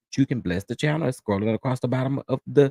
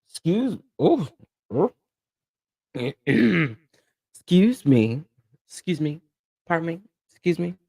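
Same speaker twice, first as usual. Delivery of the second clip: 1 coughing fit; 6 semitones higher than usual. Real, not acted. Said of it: "can you guys, excuse".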